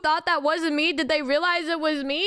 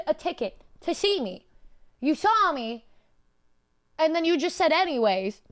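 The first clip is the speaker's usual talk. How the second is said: ordinary delivery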